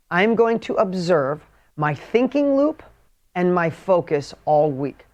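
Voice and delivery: slow, spaced-out dictation pace. Shown as a demonstration. Coach dictates the weekly assignment to followers, slowly. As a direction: dictate it slowly so they can write. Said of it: "seriously, write this down for me".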